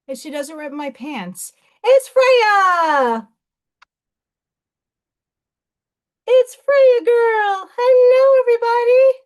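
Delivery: put-on high, loud, sing-song baby talk to the cat; excited cooing at the cat; high pet talk. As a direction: coo at the kitty like she's a baby.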